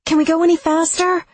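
high, loud urgent shout; yelling back. A narrator's reading. Lex yells to Seth to speed up, urgent shout. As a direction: yell forward over the engine. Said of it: "I yell back".

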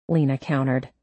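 ordinary talk

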